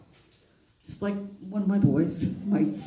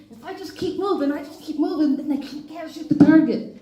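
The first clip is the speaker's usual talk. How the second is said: high, loud mock panic; retold panicked thought; 9 semitones higher, 11 dB louder than usual. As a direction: ham up a frightened Jeff dodging bullets. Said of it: "And I thought".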